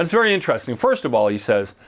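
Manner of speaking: ordinary talk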